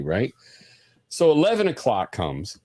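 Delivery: normal talking voice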